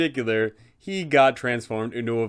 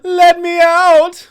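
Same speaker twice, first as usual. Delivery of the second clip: high, loud desperate shriek; screaming vampire; 13 dB louder, much slower than usual. Acted. The son in the basement begs his father, high screaming wail. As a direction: wail up from the cellar, desperate to get out. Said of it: "Doru scream out".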